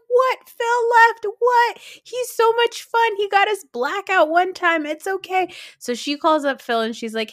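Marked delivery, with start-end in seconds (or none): 0.1-5.5 high, loud, baffled ditzy voice; imitating a ditzy friend. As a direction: play her goofy and clueless.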